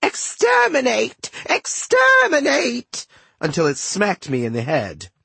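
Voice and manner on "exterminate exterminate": very high, slow robotic shriek; robot yelling. A narrator's reading. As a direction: screech it like a killer robot.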